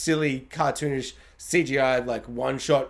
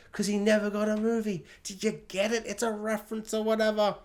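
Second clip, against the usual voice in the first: higher, softer sarcastic explaining; sarcastic-sounding, raised and softened; 4 semitones higher, 5 dB quieter than usual. Real, not acted. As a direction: spell out the joke in a mocking lilt. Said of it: "I'm making it sound like I'm being sarcastic".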